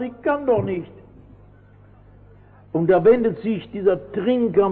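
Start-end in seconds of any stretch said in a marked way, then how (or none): 0.0-0.9 high, soft mock child's whimper; a lightly voiced weeping little boy; tied-up boy answers his father, lightly tearful. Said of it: "der Kleine fängt an zu weinen".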